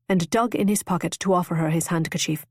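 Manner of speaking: plain reading voice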